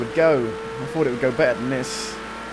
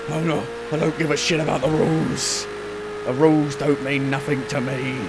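ordinary talk, nothing marked

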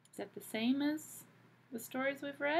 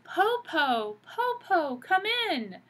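high excited shout; 9 semitones higher, 7 dB louder, slower than usual. Acted. Children shouting to let grandmother in, high and loud. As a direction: call grandma in eagerly. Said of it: "They shouted".

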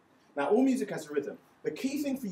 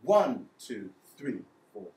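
One punched loud, three firm, two and four light; strong beats one and three; 2 semitones lower, 6 dB louder, slower than usual. Shown as a demonstration. Music teacher showing strong beats one and three, loudly counted. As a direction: stamp out the strong beats for the class. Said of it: "your next strongest beat is three".

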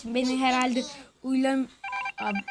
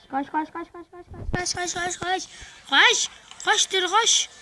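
being chased; 6 semitones higher than usual. Real, not acted.

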